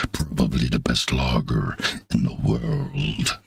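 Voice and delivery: very low, rich advert narrator; Orson Welles voice. Pretend. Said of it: "I knew you were gonna do Orson Welles".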